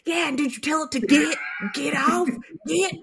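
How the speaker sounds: lightly mock a Southern kid's accent. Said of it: "he makes fun of anybody that has an accent".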